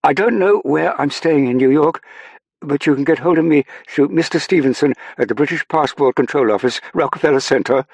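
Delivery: high, loud, sustained shout; shouting over noise. A narrator's reading. Saffron giving her contact to the airman, shouting over noise. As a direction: call the details out over a crowd. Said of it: "Saffron shouted over the noise".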